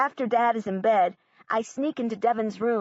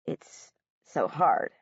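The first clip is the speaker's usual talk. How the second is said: slow, lower, near tears; a touch of a breaking voice; choked-up teacher; 6 semitones lower, slower than usual. A narrator's reading. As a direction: hold back tears on it. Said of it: "His voice is crackly".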